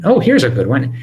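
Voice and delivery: normal talking voice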